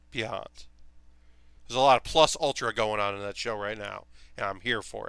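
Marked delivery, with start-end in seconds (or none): 0.1-0.7 soft echo. Real, not acted. Echoing the word beyond.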